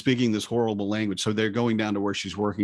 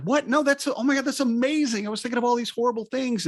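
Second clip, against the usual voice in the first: high, excited burst of relieved disbelief; retold gushing amazement, pitched way up; amazed relief. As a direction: act out an astonished, delighted dad.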